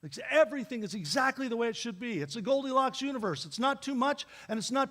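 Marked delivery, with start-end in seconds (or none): none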